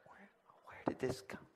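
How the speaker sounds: whispered disbelief; baffled whisper. Retold or imitated. Dad privately baffled by his son's answer, whispering. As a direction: whisper it to yourself, bewildered. Father's baffled inner reaction.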